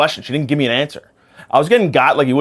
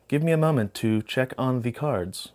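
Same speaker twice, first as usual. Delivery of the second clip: put-on slow, soft British accent; measured British fortune-teller, much softer; British psychic; 8 dB quieter, slower than usual. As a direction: stall slowly in a put-on British voice. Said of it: "I don't know why she's British".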